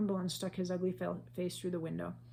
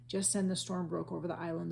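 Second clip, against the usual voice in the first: plain reading voice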